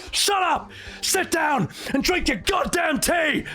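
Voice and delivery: high, drawn-out mock-furious yell; Cid's raging outburst. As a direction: order them to sit, in hammed-up fury. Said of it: "immediately explodes into a rage".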